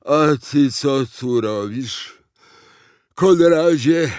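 loud, heavy character voice with a strained smile; laboured fat president. A narrator's reading. Sleepy fat president greets Konrad, laboured. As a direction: force a smile through the effort, loudly. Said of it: "uśmiechnął się z trudem i spytał".

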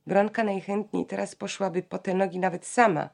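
ordinary talk, nothing marked

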